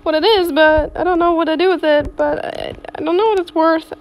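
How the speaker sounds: loud excited rambling; bursting with excitement over a gift; loud thrilled chatter. Real, not acted. Excited about free pet.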